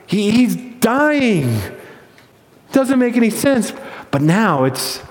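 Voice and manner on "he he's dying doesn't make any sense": drawn-out, aghast disbelief; absolutely confused. Acted. Angels watch Jesus at the cross, utterly confused. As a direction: stretch it out, stunned and uncomprehending. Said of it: "they are absolutely confused".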